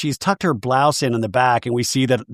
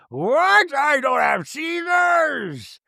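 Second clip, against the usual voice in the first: very high, drawn-out shriek of denial; mock outrage; 13 semitones higher, much slower than usual. [name] denying she has seizures, mock-outraged shriek. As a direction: wail it out in hammed-up disbelief.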